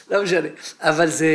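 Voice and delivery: ordinary delivery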